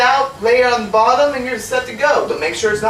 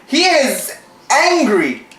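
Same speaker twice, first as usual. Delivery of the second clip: "He is angry" stretched slow, higher; slow drawn-out; 8 semitones higher, 3 dB louder, much slower than usual. Shown as a demonstration. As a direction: drag out "He is angry" slowly. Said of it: "he's angry".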